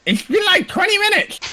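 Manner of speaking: very high, piping little voice; squeaky Bradley. Acted. Bradley toddles over and chirps in a very high, piping voice. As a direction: chirp it like a tiny helper. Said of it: "Bradley's like".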